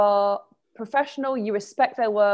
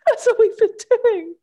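laughing; 11 semitones higher, 7 dB louder than usual. Real, not acted. Laughing at friend's late realisation.

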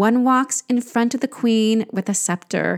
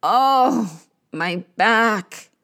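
drawn-out groan of pain; much slower than usual. Acted. Queen of the underworld in pain, groaning. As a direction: groan it from an aching body. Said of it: "Ereshkigal groans".

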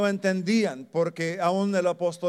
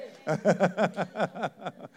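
short laugh; 3 semitones higher than usual. Real, not acted. Laughs after asking for testimony.

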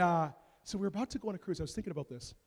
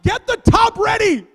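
much higher, louder shouted order; commanding wife; 13 semitones higher, 13 dB louder than usual. Retold or imitated. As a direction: bark the order mid-labor. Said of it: "She's like".

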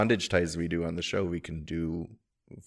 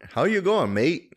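a quick, higher attempt at an Australian accent, half-hearted; 9 semitones higher, 4 dB louder, slower than usual. Shown as a demonstration. Cohost asked for an Australian accent, half-hearted Australian greeting. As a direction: toss off a shy Australian "mate". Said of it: "Give me your best Australian accent".

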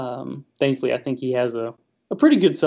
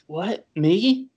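mock-surprised rising question, pitched up; incredulous; 5 semitones higher than usual. Southerner reacting to a friend's remark on his accent, incredulous. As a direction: play up innocent disbelief. Acts out own baffled reaction.

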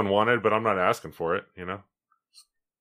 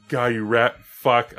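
slow, louder mock fury; mock angry curse; 4 semitones higher, 6 dB louder, slower than usual. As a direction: grumble it in fake rage at the puzzle. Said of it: "It's like".

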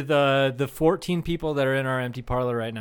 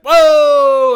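loud, high, drawn-out "whoa"; big scandalized holler; shocked objection; 10 semitones higher, 15 dB louder than usual. Shown as a demonstration. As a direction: shout down what was just said. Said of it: "you gotta be like".

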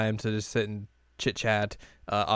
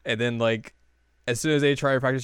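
ordinary talk, nothing marked

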